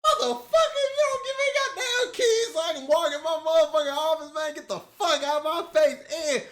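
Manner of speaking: high, loud bossy mock Ada; hammed-up boss lady telling someone off; imitating Ada. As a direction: play Ada ordering people out of her office. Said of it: "this is Boss Lady Ada".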